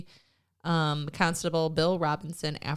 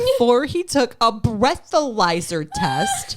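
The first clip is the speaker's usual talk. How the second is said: laughing; 7 semitones higher, 4 dB louder than usual. Real, not acted. Laughing while reading the article.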